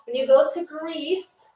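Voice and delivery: normal talking voice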